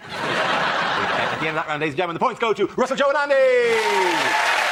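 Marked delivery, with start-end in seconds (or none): 1.8-4.7 building to a long, loud, high "Andy"; rising quiz-host fanfare; host announcement. Acted. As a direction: announce the winners like a game show host.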